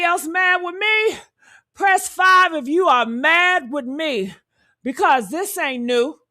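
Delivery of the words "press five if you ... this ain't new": ordinary talk, nothing marked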